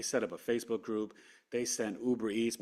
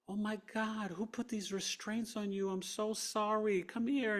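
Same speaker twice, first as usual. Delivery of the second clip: doting nurse voice; 10 semitones higher than usual. Retold or imitated. Nurse apologising to the restrained patient, high and doting. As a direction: coo over the patient like a caring nurse.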